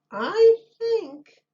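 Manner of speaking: put-on a high little boy. A narrator's reading. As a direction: pipe up like a small boy. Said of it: "said the little boy".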